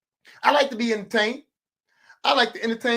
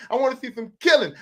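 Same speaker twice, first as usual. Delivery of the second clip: ordinary talk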